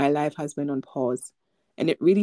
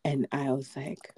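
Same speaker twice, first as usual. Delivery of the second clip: ordinary talk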